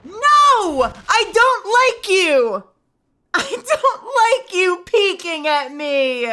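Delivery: high, loud panicked yell; scared yelling. Real, not acted. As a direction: shout in fright. Scared shouting at game monster.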